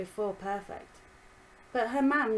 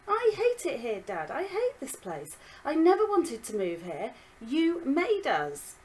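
put-on angry girl shouting at her dad, higher and louder; roaring; 6 semitones higher, 6 dB louder than usual. Violet roars at her dad that she hates it here. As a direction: blurt it out, furious and hurt. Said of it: "she roared".

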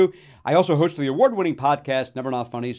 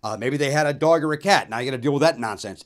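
ordinary talk

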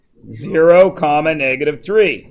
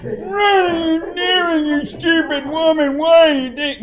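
hammed-up outburst at far too big a scale; overdone parody; 12 semitones higher than usual. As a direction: wail it in an exaggerated high voice. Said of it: "It's not".

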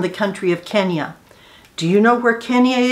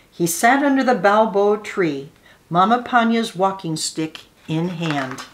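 ordinary delivery

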